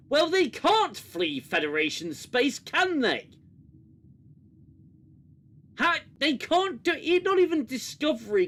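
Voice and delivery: high, loud, incredulous outburst; loud, high-pitched exasperation at a plot hole; exasperated rant. Real, not acted. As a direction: rail at the absurdity, pitch jumping up.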